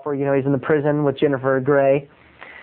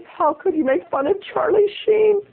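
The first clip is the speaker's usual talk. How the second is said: very high reproachful voice; mock scandalized crowd; 14 semitones higher than usual. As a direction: scold in horrified, high-pitched disbelief.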